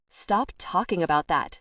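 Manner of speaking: usual speaking voice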